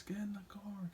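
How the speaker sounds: urgent mock whisper. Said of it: "he says".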